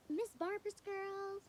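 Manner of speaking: teacher calling out. Retold or imitated. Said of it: "she was like".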